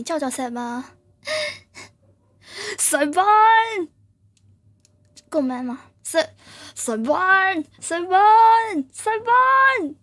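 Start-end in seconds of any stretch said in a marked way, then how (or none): 2.8-9.9 roaring call. Pretend. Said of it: "很咆哮的感觉".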